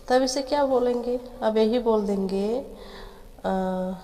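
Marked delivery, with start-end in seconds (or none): none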